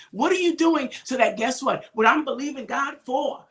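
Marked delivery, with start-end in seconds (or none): none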